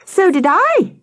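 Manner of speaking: high-pitched eager call; yelled. A narrator's reading. Nathan joining his friends, yelling high. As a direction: chime in with a high mild yell. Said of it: "Nathan yelled".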